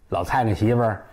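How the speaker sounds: plain reading voice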